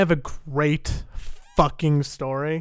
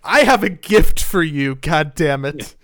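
car salesman; 7 dB louder than usual. Pretend. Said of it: "you just sound like a car salesman".

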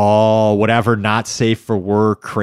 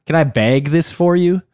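bright Minnesota shopkeeper voice, higher, "bag" said with a flat Minnesota a; Minnesota accent; 6 semitones higher than usual. Shown as a demonstration. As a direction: play a Minnesota shoe salesman offering a "bag". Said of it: "Can you say it again?".

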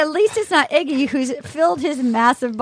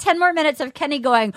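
ordinary talk, nothing marked

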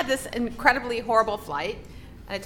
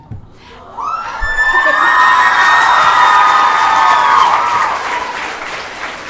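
delighted shriek; 12 dB louder than usual. Real, not acted. Meeting her idol on street.

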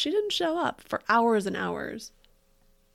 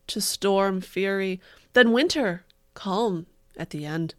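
mock dramatic poetry reading; 8 dB louder than usual. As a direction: ham up the tragic verse.

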